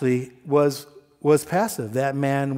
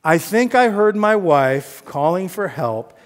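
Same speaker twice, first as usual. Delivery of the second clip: sarcastic prayer; 3 semitones higher, 7 dB louder than usual. Retold or imitated. Pastor suggesting a husband's prayer, sarcastic. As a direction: overplay a pious plea, then ease off. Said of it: "I was being sarcastic".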